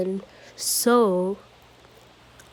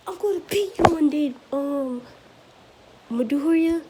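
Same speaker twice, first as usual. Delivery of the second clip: much higher, sulky challenge; defiant vow; 6 semitones higher than usual. Acted. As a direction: throw the threat at your rival. Bakugo vows revenge.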